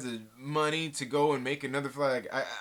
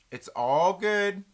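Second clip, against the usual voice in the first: drawn-out high It's all good; a sing-song reassurance, slow and loud; slow, higher, louder; 5 semitones higher, 6 dB louder, much slower than usual. Shown as a demonstration.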